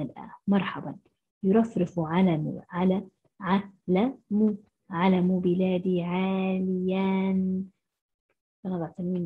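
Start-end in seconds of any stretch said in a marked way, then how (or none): 1.4-7.6 slow model reading. Shown as a demonstration. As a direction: read the line slowly and carefully. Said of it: "reading sentence slowly to pupils".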